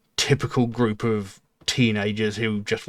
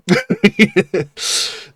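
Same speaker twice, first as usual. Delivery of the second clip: short, higher-pitched chuckle; amused laugh; 8 semitones higher, 6 dB louder than usual. Real, not acted. Film fan amused by copycat horror plots, amused laugh. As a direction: laugh loudly to yourself at the absurdity.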